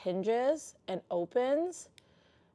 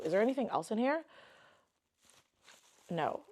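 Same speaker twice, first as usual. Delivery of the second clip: ordinary talk, nothing marked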